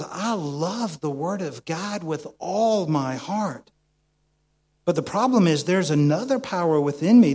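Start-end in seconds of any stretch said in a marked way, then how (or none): 0.0-3.7 slow, earnest declaration of love; heartfelt quote. Acted. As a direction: read it slowly like a sincere vow. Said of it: "Paul says".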